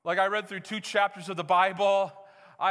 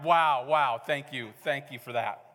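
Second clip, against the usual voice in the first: mock thanks; 5 semitones lower, slower than usual. Real, not acted. Teasing congregation's reaction to short sermon.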